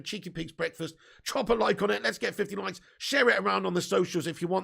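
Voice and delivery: normal talking voice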